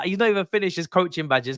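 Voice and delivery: ordinary delivery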